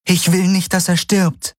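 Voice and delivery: anxious high voice; worried heroine. A narrator's reading. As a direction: heroine fearing for her friend. Heroine begs.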